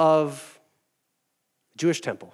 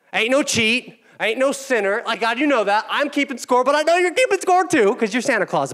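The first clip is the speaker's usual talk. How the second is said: boastful self-praise; 8 semitones higher, 3 dB louder than usual. Acted. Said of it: "enough improv".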